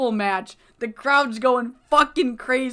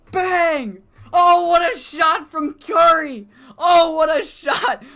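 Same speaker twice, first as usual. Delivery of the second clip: excited yelling; 5 semitones higher, 4 dB louder than usual. Real, not acted. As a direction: lose it completely over the match result. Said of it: "I'm sorry, I'm excited".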